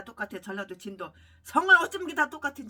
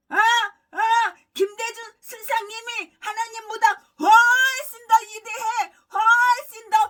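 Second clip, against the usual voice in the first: higher, louder wailing mock praise; mock wailing devotee; 9 semitones higher, 10 dB louder, slower than usual. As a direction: wail like a fawning disciple. Mocking the pastor's praise of Kim. (김대중을 찬양하는 목사를 울부짖듯 흉내)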